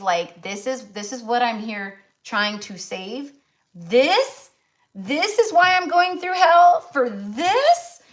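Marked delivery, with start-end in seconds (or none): 3.7-8.0 mock despair. Mock-dramatic exasperated outburst.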